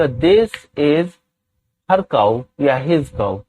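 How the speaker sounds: each word spaced out slowly for students to copy; very slow, deliberate classroom dictation. Shown as a demonstration. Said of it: "teacher dictates English sentence".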